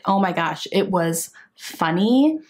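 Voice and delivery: usual speaking voice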